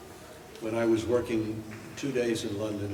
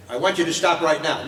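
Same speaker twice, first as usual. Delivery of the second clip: louder line read; 6 semitones higher, 10 dB louder than usual. Retold or imitated. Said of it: "I said, "Okay".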